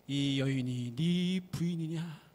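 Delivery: slow, stately spirit voice; mountain god. Acted. Mountain god presenting the woman, slow and solemn. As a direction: ask it slowly, like a grand old sage.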